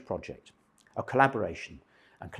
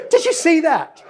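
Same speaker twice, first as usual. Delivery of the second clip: much higher and louder shocked cry; shocked exclamation; 15 dB louder than usual. Retold or imitated. Karen reacting to the naked man on the home video, shrieking in shock. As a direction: squeal it out like you've just seen something scandalous.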